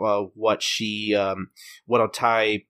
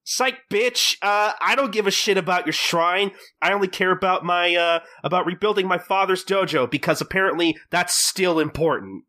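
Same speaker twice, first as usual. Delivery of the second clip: very high gleeful taunt, settling into high bragging; spring the fake-out on them, imitating a bratty rival; mock taunting; 7 semitones higher than usual. Hostess drops fake sympathy for her rival, mock-taunting.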